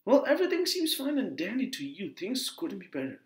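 high-pitched, indignant jab; angry sarcasm. Acted. Acted quarrel with father.

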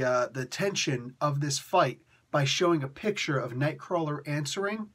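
normal talking voice

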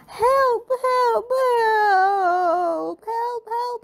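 loud, high calls fading like an echo; the shout rolling back around the chamber; echoing cries. A narrator's reading. The echo of Mister Noisy's shout, loud then fading. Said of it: "deafening echo that rolled and reverberated".

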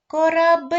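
"Корабель" called high and loud, last syllable held; calling out. Shown as a demonstration. Teacher calling with pupils, "Корабель" shouted with a long final syllable. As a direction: hail the ship, stretching "бель" at the end of "Корабель". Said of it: "Тепер давайте погукаємо".

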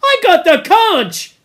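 high, loud protest; Piggy indignantly claiming the conch. Acted. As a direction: insist on your right to speak. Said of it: "said Piggy indignantly".

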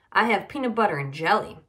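declaring it to everyone; announcing to class. A narrator's reading. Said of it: "Natty announced to the class".

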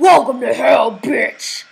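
loud, rough devil growl. Acted. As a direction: roar it like a devil. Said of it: "like the devil voice".

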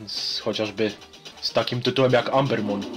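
ordinary talk, nothing marked

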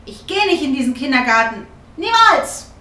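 put-on loud, defiant child's refusal, jumping high at the end; sulky shout. A narrator's reading. Girl refusing kindergarten from under the blanket, sulky shout. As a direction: call it out in a big sulk. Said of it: "ruft sie unter der Decke hervor und schmollt".

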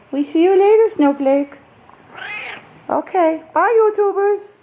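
high pet voice farewell; cooing goodbye. Real, not acted. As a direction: coo bye to the kitty. Cooing goodbye to cat and viewers.

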